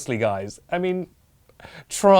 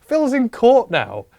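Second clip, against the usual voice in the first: high, loud breathless rush; frantic storytelling; 12 semitones higher, 9 dB louder than usual. Acted. Narrator blurting the violent twist to viewers, frantic. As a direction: tell the twist in a frenzy.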